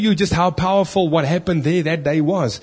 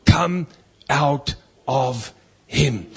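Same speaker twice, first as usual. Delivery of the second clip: slow, measured stern command; much slower than usual. Acted. Preacher quoting Jesus ordering a demon out, stern and slow. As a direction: order it out word by word, slowly. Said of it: "Jesus said sternly".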